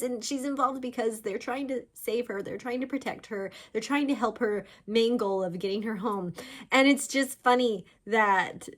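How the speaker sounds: ordinary talk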